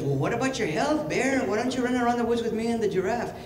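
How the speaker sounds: high-pitched little rabbit; rabbit character. Acted. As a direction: coax a friend in a high cartoon rabbit voice. Rabbit urges bear to run.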